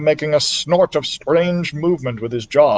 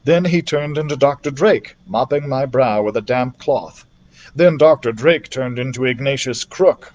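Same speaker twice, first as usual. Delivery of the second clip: ordinary delivery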